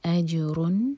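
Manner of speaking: أَجْرٌ with ج bounced and a clear نون; bounced jeem. Shown as a demonstration. Teacher models qalqala and izhar. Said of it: "نون کے sound کو clear پڑھیں گے".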